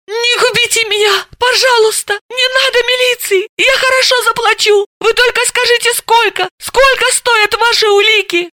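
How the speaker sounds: high, desperate pleading on her knees; begging plea. Acted. Kneeling suspect begging detective not to call police. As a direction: beg for mercy, voice up high. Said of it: "взмолилась".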